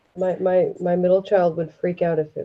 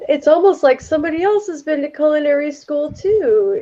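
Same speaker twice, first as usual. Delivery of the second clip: higher-pitched snarky impersonation; imagined snarky remark; 7 semitones higher than usual. Acted. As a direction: voice his snarky jab, pitched up. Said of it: "I could hear him saying".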